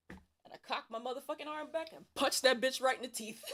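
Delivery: loud, laughing build to the punch; excited climax. Real, not acted. Telling friends about the punch, laughing and loud. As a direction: shout the payoff through a grin.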